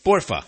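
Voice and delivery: "Porfa" said fast as two syllables; "Porfa" clipped, informal. Shown as a demonstration. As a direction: cut "Porfa" short and casual. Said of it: "slur it together and cut it in half".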